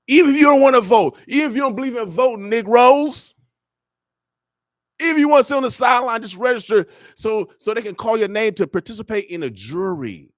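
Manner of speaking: loud, high-pitched rallying cry; fired-up exhortation. Real, not acted. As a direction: urge the crowd on, high and forceful. Exhorting the audience to register.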